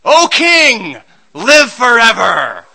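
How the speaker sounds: loud, drawn-out call rising from the pit; booming call from the pit. Pretend. Daniel calling up to the king from the lions' den, loud and slow. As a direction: proclaim it slowly from below. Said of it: "you'd hear the voice of Daniel".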